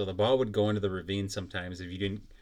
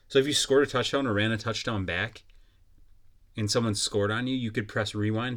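ordinary delivery

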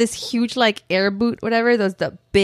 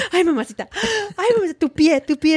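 high, fretful mother's wailing; mock-horrified, pitched up; imitating aghast mom; 7 semitones higher, much faster than usual. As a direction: fuss in horror like a Mexican mom.

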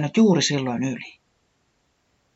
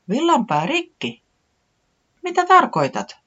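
much higher, amazed question; astonished; 13 semitones higher than usual. A narrator's reading. Taputi amazed by Tiputi's news, high and astonished. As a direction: gasp it in surprise. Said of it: "hämmästyi Taputi".

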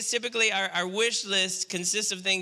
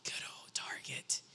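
breathy reverent whisper; hushed fond aside; 15 dB quieter than usual. Real, not acted. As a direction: confide your love for the store. Aside about the store.

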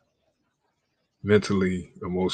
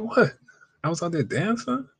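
mock innocent surprise; 8 semitones higher than usual. Girlfriend acting innocent when confronted.

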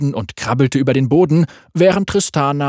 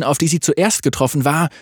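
usual speaking voice

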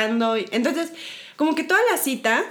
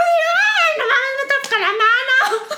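much higher squeal through laughter, slowing on the hand; giddy inner squeal; 13 semitones higher, 4 dB louder, much slower than usual. Retold or imitated. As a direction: squeal it like a girl whose crush just touched her hand. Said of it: "Y por dentro como de". (chillido interior muy agudo entre risas)

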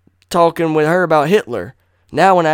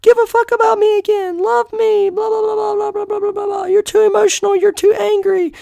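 squeaky falsetto mocking an ex-girlfriend; mock her in a much higher whiny voice, loud and dismissive; 5 dB louder than usual. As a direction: whine it in a high shrill mimic of a nagging girlfriend. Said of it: "then you wanna come".

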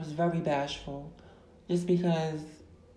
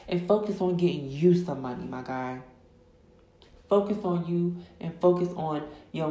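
rising, louder hype-up sermon; preaching at full force; 2 semitones higher, 5 dB louder than usual. Acted. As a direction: fire up the listeners like a preacher.

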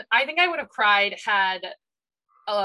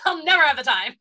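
laughing; 8 semitones higher, 5 dB louder than usual. Retold or imitated. Laughing at her own excuse.

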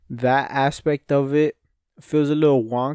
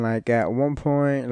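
ordinary talk, nothing marked

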